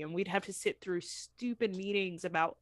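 usual speaking voice

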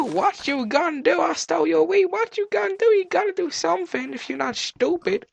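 high-pitched mock challenge; taunting. Player taunting a villager after stealing wheat, high-pitched taunting.

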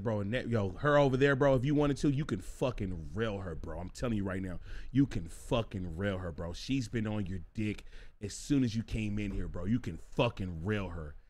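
low, hushed, insistent bro talk; confidential urging. Acted. Guy tipping off his buddy about a woman, confidential urging. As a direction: urge him on under your breath.

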